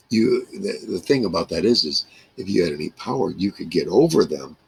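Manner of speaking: ordinary talk, nothing marked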